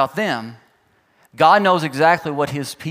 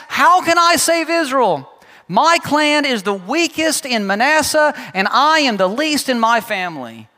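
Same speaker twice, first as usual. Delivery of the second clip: whiny Gideon; 6 semitones higher than usual. Retold or imitated. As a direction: beg off the job, sounding small. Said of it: "Gideon asks".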